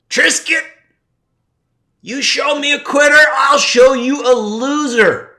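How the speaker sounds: high, loud shouting sensei; teacher's yell. Retold or imitated. Recalling his teacher screaming at him in his head, a full high shout. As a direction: bellow it like a drill-hall sensei. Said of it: "he did that yelling thing".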